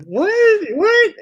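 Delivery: high-pitched baffled double what; confused squeaky what, what. Retold or imitated. Man confused by a stranger's reply, squeaky what. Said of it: "And I was like".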